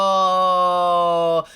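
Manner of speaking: long, loud, drawn-out no; appalled disbelief. Real, not acted. Viewer hearing of the horse-headed father, loud drawn-out disbelief. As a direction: stretch it out loudly.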